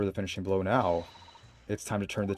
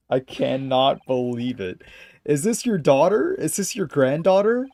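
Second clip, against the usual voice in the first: high, loud laughing questions; laughing disbelief; 6 semitones higher, 10 dB louder, slower than usual. Real, not acted. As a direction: crack up in disbelief.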